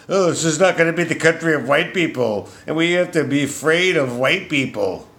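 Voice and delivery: high mocking voice mimicking a politician; lightly mocking impression; mock quote. Mocking Ilhan Omar's words.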